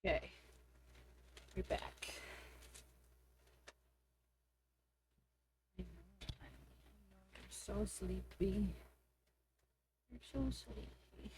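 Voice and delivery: sleepy talk to pet. Real, not acted. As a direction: softly coo at a drowsy puppy.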